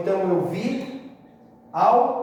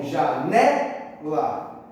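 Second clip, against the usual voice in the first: "janela" slowed, weight on NE; stress on penultimate syllable; 3 semitones lower, much slower than usual. Shown as a demonstration. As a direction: hit the middle syllable of "janela". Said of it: "em que a sílaba tônica está aqui".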